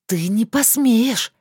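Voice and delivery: slow, loud, breathy warning; breathed out angrily. Acted. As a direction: push it out on one breath. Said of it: "выдохнула я".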